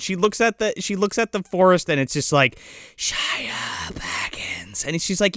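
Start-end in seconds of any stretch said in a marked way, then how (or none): 3.0-4.8 slow, eerie imitated whisper; mock ring whisper. The ring calling Elodie into the forest, slow whisper. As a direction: whisper it slowly like a beckoning ring. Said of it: "called by the fucking ring".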